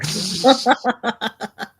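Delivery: high, slow laughing wrap-up. Real, not acted. Laughing at own story.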